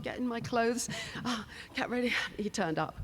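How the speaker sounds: ordinary delivery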